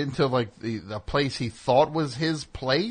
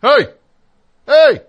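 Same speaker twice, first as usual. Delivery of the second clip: loud high yell into an open door; 10 semitones higher, 15 dB louder than usual. Retold or imitated. Speaker yelling upstairs for the neighbours, full shout. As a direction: bellow to get the neighbours' attention. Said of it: "I started yelling into the door".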